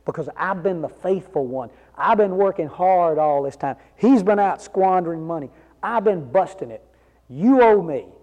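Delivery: resentful son demanding what he's owed, rising in volume; angry demand. Acted. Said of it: "He's angry".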